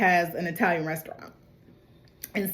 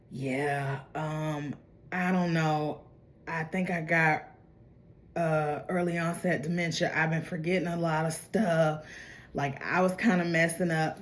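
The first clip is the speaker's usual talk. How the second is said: lower, softer hesitant excuse; sheepish mumble; 4 semitones lower, 8 dB quieter than usual. Retold or imitated. Michael excusing himself to Dutch, low sheepish mumble. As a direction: play dumb, mumbling like you forgot.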